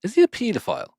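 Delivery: high, startled "Is he a pedophile?"; shocked question. Real, not acted. Asking his friend about Freddy Krueger, startled. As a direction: blurt it out in disbelief.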